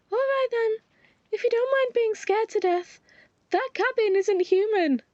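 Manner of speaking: spooky-teasing companion. Acted. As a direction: try to scare him a little.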